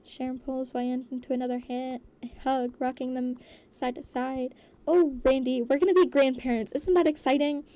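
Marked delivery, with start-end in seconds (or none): 4.9-7.6 excited. A narrator's reading. Acted delighted grandmother-to-be.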